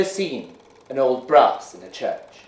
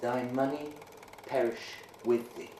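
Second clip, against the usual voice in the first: low, much softer murmur over the grave; hushed, solemn murmur; 13 dB quieter than usual. A narrator's reading. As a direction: say it low, like a quiet curse. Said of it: "In a low voice he said".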